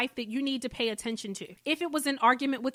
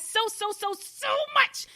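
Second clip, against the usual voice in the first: slow, drawn-out and much higher, squealing with affection; gushing; 10 semitones higher than usual. Real, not acted. Host gushing over her listeners at the end, high stretched squeal. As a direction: stretch each so up high, overflowing with love.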